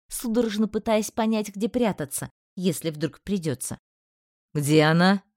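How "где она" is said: sharp, loud demand in a low male voice; harsh voice. Acted. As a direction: bark the question at him. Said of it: "услышала я резкий голос магистра".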